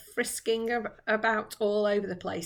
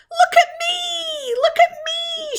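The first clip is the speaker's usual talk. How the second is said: very high, loud, drawn-out yell; excited shout; 9 dB louder, slower than usual. A narrator's reading. Centipede washed clean of paint, shouting excitedly. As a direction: shout it slowly at the top of the voice. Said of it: "shouted the centipede excitedly".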